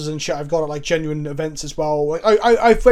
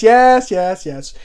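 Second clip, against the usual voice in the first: mock-eager yeses, higher and louder; dismissive assent; 3 semitones higher, 7 dB louder, much slower than usual. French opponent waving off the question, retold dismissive assent. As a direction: imitate an opponent brushing it off.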